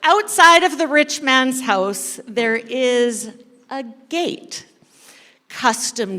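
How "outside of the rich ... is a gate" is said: ordinary talk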